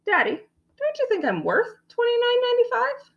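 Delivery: put-on small, very high child voice; high, quick, timid question; quiet little girl. A narrator's reading. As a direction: ask in a tiny, pleading voice. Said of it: "Taya quietly said".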